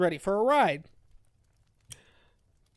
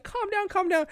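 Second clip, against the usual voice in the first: mock soothing; 11 semitones higher than usual. Character soothing panicking heroine.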